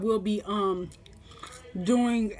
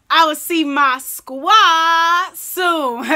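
loud, slow drawn-out sign-off; signature catchphrase; 5 semitones higher, 9 dB louder, much slower than usual. Shown as a demonstration. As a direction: stretch out the catchphrase big. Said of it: "like I always say".

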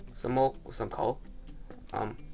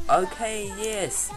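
excited; 10 semitones higher, 4 dB louder, much slower than usual. Real, not acted. Game finally lets him edit.

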